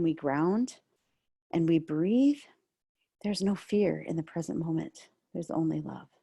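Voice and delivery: soft, low meditation voice; hushed guided meditation. Acted. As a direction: ease them into the body, softly.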